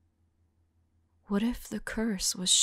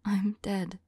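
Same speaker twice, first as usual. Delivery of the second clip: ordinary talk, nothing marked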